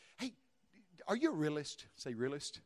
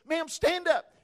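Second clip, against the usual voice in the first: loud and much higher, calling across a hall; 12 semitones higher, 15 dB louder, slower than usual. Retold or imitated. Preacher calling the old lady up in the arena, loud. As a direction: call her up from the stage.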